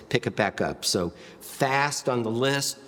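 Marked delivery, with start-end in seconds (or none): none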